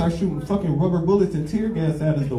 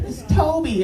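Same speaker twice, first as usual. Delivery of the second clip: high, timid reply; meek mock Toby; 14 semitones higher, slower than usual. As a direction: answer small and scared. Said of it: "He's like".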